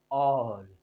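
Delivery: "ऑल" low and soft, vowel drawn out with air; low, held "ऑल"; air released. Shown as a demonstration. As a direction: let air out through a long "ऑल". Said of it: "air निकलने का".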